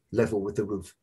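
ordinary delivery

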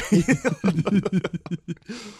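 loud, high burst of laughter; real laugh, pitch jumping up; big high laugh. Real, not acted. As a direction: crack up loudly. Laughing at the story.

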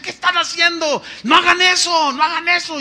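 high-pitched, urgent reenacted rebuke; retold scolding. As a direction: scold a crowd as you once did. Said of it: "los regañaba y les decía".

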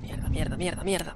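whispered, tense swearing; cursing under breath. Real, not acted. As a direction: hiss the curses under your breath. Enemy spots him in game.

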